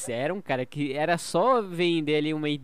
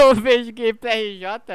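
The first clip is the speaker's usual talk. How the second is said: louder, slower, hammed-up; loud joking; 8 semitones higher, 15 dB louder, slower than usual. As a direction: belt it out as a joke.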